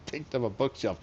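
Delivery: ordinary delivery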